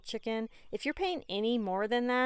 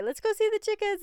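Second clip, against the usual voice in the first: put-on eager child; 11 semitones higher, 3 dB louder, faster than usual. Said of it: "Like, every day".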